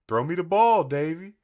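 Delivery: high, loud sweet-talking dog; cartoon dog voice. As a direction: coax like a sly cartoon dog. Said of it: "dog was like".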